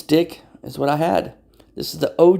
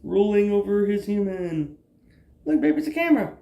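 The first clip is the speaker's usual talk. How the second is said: high pet voice; 6 semitones higher than usual. Real, not acted. Owner cooing to his cat about the camera. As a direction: coo it to the cat.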